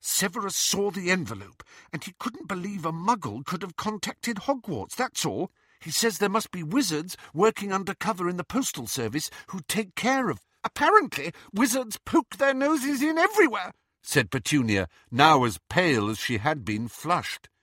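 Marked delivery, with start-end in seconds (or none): 0.0-10.5 high-pitched earnest explaining; defensive. A narrator's reading. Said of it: "Now Lily was on the defensive". 10.6-13.7 high, loud, bitter sarcasm; indignant. A narrator's reading. Petunia furious at wizards' meddling.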